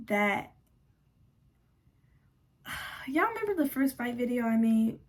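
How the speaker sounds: ordinary delivery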